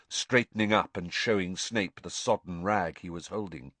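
usual speaking voice

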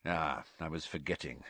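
soft, low mocking tease; gruff old man, quietly sardonic. A narrator's reading. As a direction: needle him with a dry smile. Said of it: "with a sardonic smile".

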